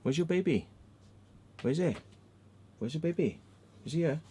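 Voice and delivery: high, teasing questions; high-pitched pet voice. Real, not acted. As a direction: ask a pet excitedly where its toy is. Baby talk to his dog.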